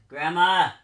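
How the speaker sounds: high-pitched call for grandma. Real, not acted. Calling grandmother for help.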